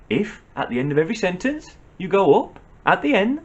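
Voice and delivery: rising question tune on "sentence", "up" and "end"; rising inflection. Shown as a demonstration. Scolder mimicking uptalk to the young, rising inflection. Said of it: "A rising inflection".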